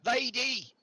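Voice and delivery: high, loud call of Lady. Acted. Villager calls the lady out of her tent, loudly.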